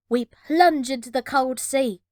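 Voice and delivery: ordinary delivery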